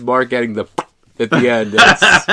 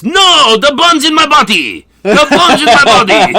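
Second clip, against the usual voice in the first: much higher, louder squeal; protesting chauffeur; 9 dB louder than usual. Retold or imitated. Chauffeur protesting to his boss, hammed-up high squeal. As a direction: cry it out in a hammed-up foreign voice, laughing. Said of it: "And he says".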